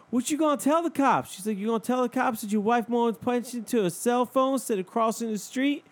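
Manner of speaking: mocking, high-pitched lady. The lady mocking the man, high mock voice. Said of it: "the lady was like".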